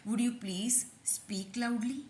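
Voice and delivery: each word stretched out very slowly; slow drill. Shown as a demonstration. Teacher drilling the polite request very slowly. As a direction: repeat it slowly so they can copy. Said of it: "तो हम उनसे बोलेंगे".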